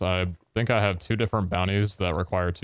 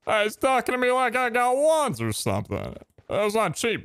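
higher-pitched mock-indignant put-on voice; grumbling character. Character grumbling about being asked for wands, mock indignation. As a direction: complain to onlookers in character.

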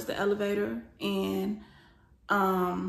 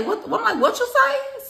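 very high, loud "what?"; retold shriek of confrontation; outraged challenge; 13 semitones higher, 8 dB louder, slower than usual. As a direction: demand a repeat, voice shooting up. Retold confrontation on overhearing remark.

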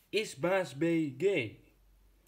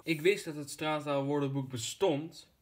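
ordinary talk

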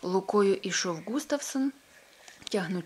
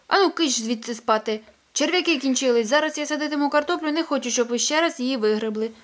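louder, brisk shooing of hens; old Pettson shooing hens; 6 semitones higher, 8 dB louder than usual. Acted. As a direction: chase the hens off to bed.

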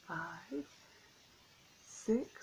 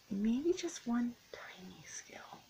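slow breathy whisper; whispered thought; 4 semitones higher, 5 dB quieter, slower than usual. Acted. Rainbow fish thinks it over.

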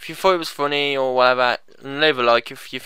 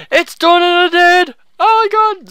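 high, loud panicked yell; over-the-top zombie alarm; mock panic; 14 semitones higher, 8 dB louder than usual. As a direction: shriek it like a hammy horror victim. Zombies appear in the game.

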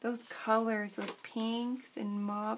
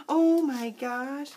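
excited; 7 dB louder, much slower than usual. Real, not acted. Unwraps gift paper.

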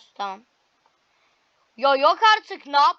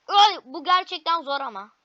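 normal talking voice

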